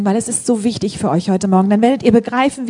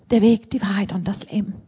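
whisper; 5 dB quieter than usual. Real, not acted. Preacher drops to whisper.

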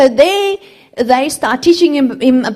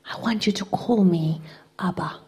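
tender whisper; 6 dB quieter than usual. Retold or imitated. God speaking to the boy on the dump: tender whisper. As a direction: breathe it like a loving father.

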